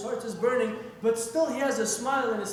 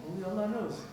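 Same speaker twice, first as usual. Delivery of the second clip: ordinary delivery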